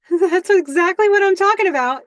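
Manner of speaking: high, loud nervous enthusiasm; flustered. Acted. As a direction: overeager and rushed to agree. Warren scrambling for a response.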